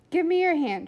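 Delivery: urgent command. Acted. Flora reaches for William in the dark, urgent and loud. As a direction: demand the hand, urgently.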